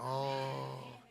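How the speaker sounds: normal talking voice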